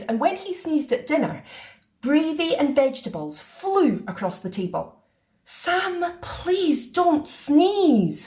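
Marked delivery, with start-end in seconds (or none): 5.4-8.3 sighing. A narrator's reading. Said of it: "sighed his mum".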